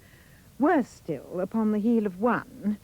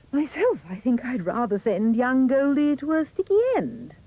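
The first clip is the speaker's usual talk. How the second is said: plain reading voice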